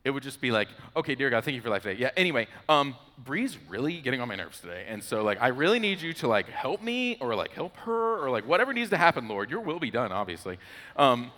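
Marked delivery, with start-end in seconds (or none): 0.9-10.6 rushed rote prayer. Retold or imitated. Rote prayer becomes a reflex.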